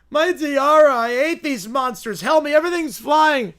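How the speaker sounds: high, loud panicked shriek; screaming character. Acted. Cartoon princess screams as buildings shake around her. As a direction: shriek as the world falls apart. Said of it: "the cartoon characters start screaming".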